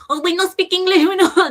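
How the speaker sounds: mock Chinese accent. Host imitating daycare staff, in a botched Chinese accent. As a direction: imitate broken English in a hammed-up Chinese accent. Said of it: "that's Chinese accent".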